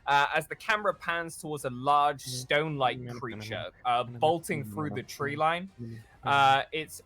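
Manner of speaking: game master painting a chase scene; dramatic scene narration. Acted. Game master setting the scene for players, cinematic narration. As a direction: narrate it like a movie trailer.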